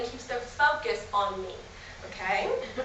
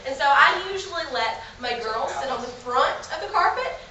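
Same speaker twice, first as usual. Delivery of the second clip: ordinary delivery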